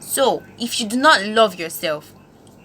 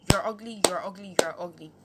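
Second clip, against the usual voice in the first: hushed taunting; 9 dB quieter than usual. Retold or imitated. Bully constantly telling someone they're ugly, hushed imitation. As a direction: murmur the insult over and over. Voicing a bully's repeated insult.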